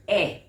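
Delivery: E as a loud, isolated "eh"; short E sound. Shown as a demonstration. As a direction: say E as the vowel that opens elephant. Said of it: "What should this sound like?".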